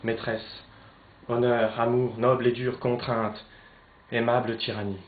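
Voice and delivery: loud, ringing tragic outcry; impassioned declamation. Acted. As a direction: proclaim each opposing claim with force. Torn between honour and love.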